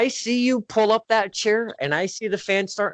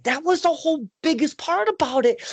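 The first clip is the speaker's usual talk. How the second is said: ordinary talk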